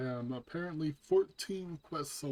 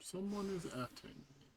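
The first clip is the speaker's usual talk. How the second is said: voice crack; 7 semitones higher, 10 dB quieter than usual. Real, not acted. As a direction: voice cracks up high on the words. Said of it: "Yeah, voice crack".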